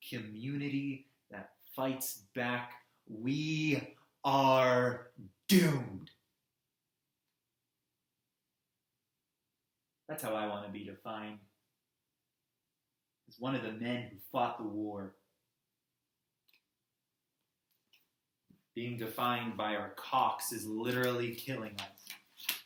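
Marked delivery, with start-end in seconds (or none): 3.1-6.1 loud, separated words crashing on "doomed"; dire pronouncement. Acted. Activist warning his community of doom, loud and emphatic. Climax of speech. 10.1-22.5 low, sombre, weary appeal; grave plea. Acted. Man asking to be defined as a fighter, gravely.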